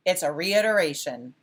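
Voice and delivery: firm falling reiteration; It's a reiteration. said as a plain confident statement; asserted answer. Shown as a demonstration. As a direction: declare it, no question. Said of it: "I'm gonna assert it".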